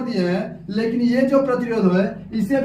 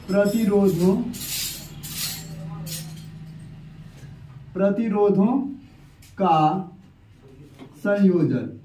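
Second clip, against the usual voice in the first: word by word, long pauses between; low, drawn-out dictation, falling away at the end; writing-out dictation; 3 semitones lower, 4 dB quieter than usual. Real, not acted. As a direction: voice the words slowly as you write them. Dictates heading while writing it out.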